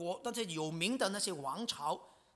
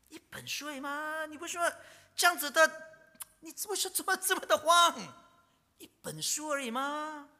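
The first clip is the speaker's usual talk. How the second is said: high, incredulous imitation with loud bursts; mocking disbelief; 9 semitones higher, 4 dB louder than usual. Preacher imitating a scoffing listener, high and mocking. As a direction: scoff as if a book were nothing. Preacher mocks a dismissive reaction.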